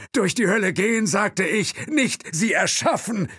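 high-pitched, loud indignant crowing; crowing outburst. Acted. Thompson berating the driver in a crowing shout. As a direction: protest loudly, voice pushed right up high. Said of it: "krähte Thompson".

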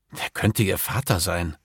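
ordinary delivery, nothing marked

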